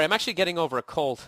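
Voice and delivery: ordinary talk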